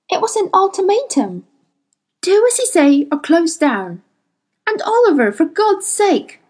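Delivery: high heated protest; hotly. Acted. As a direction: plead angrily, voice up high. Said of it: "she said hotly".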